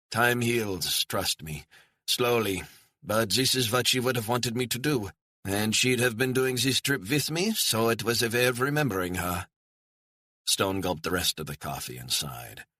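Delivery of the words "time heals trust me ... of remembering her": lightly voiced German man, higher and gently wistful; a hint of a grieving German retiree; German widower. A narrator's reading. Widower telling Stone how he remembers his wife, lightly voiced. Gerhard's voice, held.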